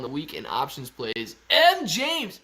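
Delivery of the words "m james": loud, high name call. Real, not acted. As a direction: holler for someone in the crowd. Calling out to a viewer.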